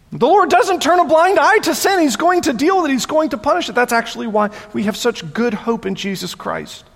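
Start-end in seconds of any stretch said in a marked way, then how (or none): none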